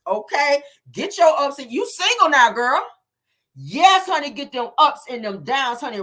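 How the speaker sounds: loud, high, cheering her on; excited hype. Acted. A fan loudly hyping up a single friend, excited shout.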